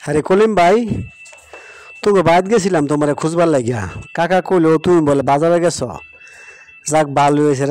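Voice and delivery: loud, hearty greeting called down the road; calling out. Acted. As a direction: call out to a friend you've spotted at a distance. Calls out to Kolim on road.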